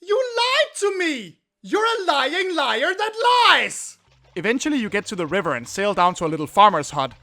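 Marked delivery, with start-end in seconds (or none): 0.0-4.0 very high, drawn-out whine; wounded accusation. Pretend. Player accuses the lamp seller of lying, wounded whine. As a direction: wail like a betrayed child, hammed up.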